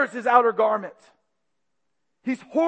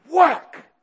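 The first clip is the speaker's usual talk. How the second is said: slap sound effect; 8 semitones higher, 9 dB louder than usual. Retold or imitated. Imitating a blow.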